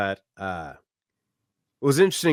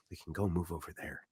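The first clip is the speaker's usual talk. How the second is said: very soft, lowered voice; discreet aside; 5 semitones lower, 14 dB quieter than usual. Retold or imitated. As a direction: say it quietly to a companion. Imitating lady discreetly wanting to move.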